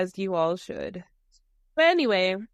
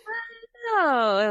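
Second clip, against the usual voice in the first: excited squeal; 11 semitones higher than usual. Real, not acted. Agreeing in shared shock.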